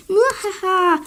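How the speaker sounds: mouahaha de méchant forcé; rire de méchant. Acted. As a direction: ricane comme un vilain de dessin animé. (rire maléfique surjoué)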